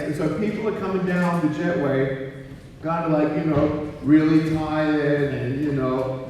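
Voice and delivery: normal talking voice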